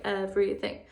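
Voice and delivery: EVERYTHING run together in three syllables. Shown as a demonstration. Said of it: "say it a little bit faster".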